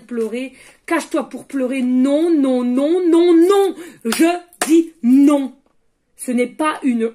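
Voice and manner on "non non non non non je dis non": emphatic no. Real, not acted. Refusing the taboo on crying.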